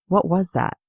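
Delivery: ordinary delivery, nothing marked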